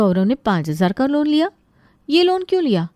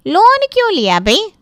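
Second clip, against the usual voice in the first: higher, louder disbelief; aunt startled by the loan; surprised aunt; 6 semitones higher, 5 dB louder than usual. Acted. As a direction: react in shock.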